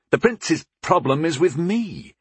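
high, heated protest; angry. A narrator's reading. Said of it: "I said angrily".

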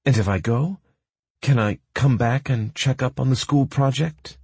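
a hint of an eager young woman; Pearl's voice. A narrator's reading.

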